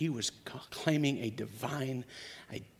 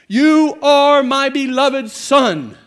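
booming, slow, much higher voice from heaven; voice of God; 12 semitones higher, 17 dB louder, slower than usual. Acted. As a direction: proclaim it slowly like God speaking. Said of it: "a voice came from heaven".

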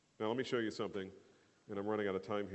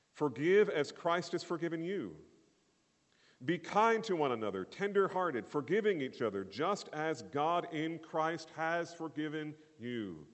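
preaching Paul; 5 semitones higher, 4 dB louder than usual. Acted. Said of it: "the Apostle Paul's response".

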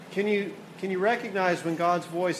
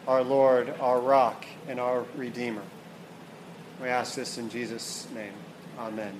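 closing prayer; 4 semitones lower than usual. Acted. Pastor leads the congregation in closing prayer, hushed and reverent.

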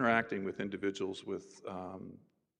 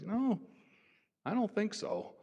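much higher, innocent and unbothered; pitched-up friend voice, matter-of-fact; Charlie's innocent reply; 13 semitones higher, slower than usual. Retold or imitated. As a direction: answer sweetly, like it's obvious.

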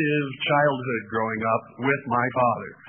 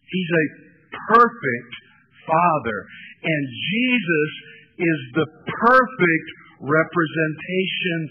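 louder, higher preaching, slowing on each phrase; preaching at full force; 4 semitones higher, 6 dB louder, slower than usual. Acted. Pastor proclaiming the perfect Father to the congregation, full-force preaching. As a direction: drive it home from the pulpit, word by word.

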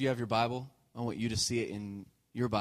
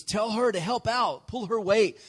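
much higher, exasperated complaint; frustrated Martha; 8 semitones higher, 4 dB louder than usual. Retold or imitated. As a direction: whine at Jesus about her sister. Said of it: "Martha's angry and frustrated".

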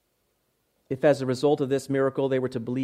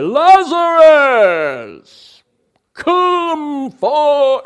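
huge drawn-out shout, pitched way up; loud cry; 12 semitones higher, 10 dB louder, much slower than usual. Acted. Preacher acts Jesus calling Lazarus from the tomb, loud cry. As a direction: cry it out at full voice to raise the dead. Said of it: "He shouted greatly".

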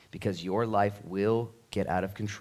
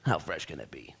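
sly curiosity; 6 semitones lower, 6 dB quieter than usual. Retold or imitated. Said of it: "And you're like".

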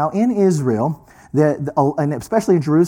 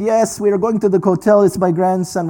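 bad Israeli accent; 6 semitones higher than usual. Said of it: "This is my really bad Hebrew accent".

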